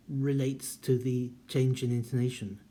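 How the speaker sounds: usual speaking voice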